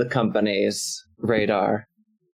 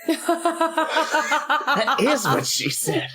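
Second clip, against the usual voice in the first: much higher, slow hammed-up laugh line; mock high laughing punchline; silly laughing voice; much slower than usual. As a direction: drag it out through laughter.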